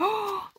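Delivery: sharp high gasp of delight; quick excited intake of breath. Real, not acted. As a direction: catch your breath in surprise at something pretty. Sees the paper design.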